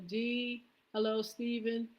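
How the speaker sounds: normal talking voice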